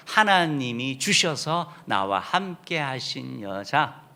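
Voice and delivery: ordinary delivery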